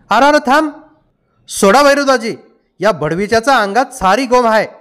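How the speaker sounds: high-pitched, urgent outburst; urgent protest. A narrator's reading. Villagers arguing as father lifts stones, urgent. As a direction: burst out to halt him. Bystander urgently stops, then argument.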